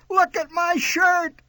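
high, drawn-out wail of dismay; scream. A narrator's reading. Child shows mother the smudged shirt, screaming. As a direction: scream it slowly in horror. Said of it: "I screamed".

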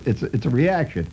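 usual speaking voice

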